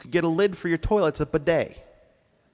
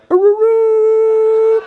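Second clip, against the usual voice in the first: slow, high, loud wolf howl; primal howl; 12 dB louder, much slower than usual. Retold or imitated. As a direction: howl at the night sky. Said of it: "all of a sudden you're like".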